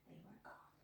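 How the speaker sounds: softly whispered ending; whispered vanishing. A narrator's reading. Narrator whispers them away.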